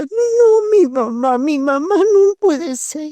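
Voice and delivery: high, sobbing cry, slowing on the last words; daughter crying. Retold or imitated. Daughter sees her thin mother at the prosecutor's office, crying. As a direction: retell the daughter's wail through tears. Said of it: "Mi hija lloraba".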